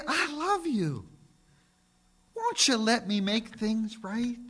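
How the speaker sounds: God's tender voice. Acted. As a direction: plead gently as God calling. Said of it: "He'd say".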